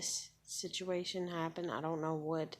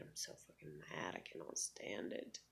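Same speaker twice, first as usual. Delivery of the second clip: muttered anger; 11 semitones lower, 14 dB quieter than usual. Real, not acted. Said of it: "I'm so fucking irritated".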